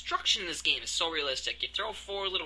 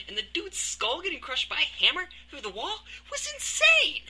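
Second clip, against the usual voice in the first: excited; 12 semitones higher than usual. Real, not acted. Raving about game demo.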